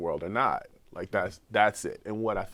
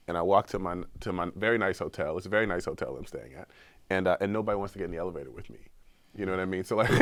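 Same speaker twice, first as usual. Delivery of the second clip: usual speaking voice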